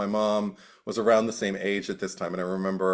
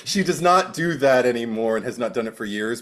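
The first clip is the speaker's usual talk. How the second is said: ordinary talk, nothing marked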